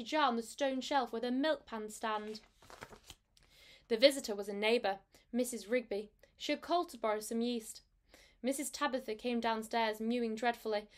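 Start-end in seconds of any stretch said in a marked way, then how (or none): none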